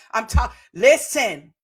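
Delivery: normal talking voice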